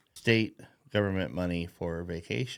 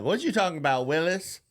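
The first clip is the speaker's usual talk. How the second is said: higher, mock Arnold catchphrase voice; sitcom catchphrase; 7 semitones higher than usual. Teasing a friend with a TV catchphrase, mock delivery. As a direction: toss off the old TV line.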